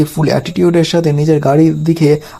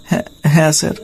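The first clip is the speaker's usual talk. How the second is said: trembling with fear; faster than usual. A narrator's reading. Bodyguard answers his angry boss, mildly trembling. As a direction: stammer it out, mildly scared. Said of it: "ভয়ে কাঁপতে কাঁপতে বলছে".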